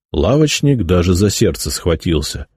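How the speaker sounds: ordinary delivery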